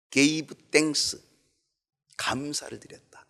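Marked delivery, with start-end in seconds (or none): 2.2-3.3 감사를 드렸다 whispered with no voice; first 감사를 드렸다 hushed and unvoiced. Shown as a demonstration. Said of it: "repeats the phrase two ways".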